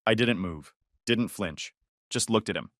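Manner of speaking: ordinary delivery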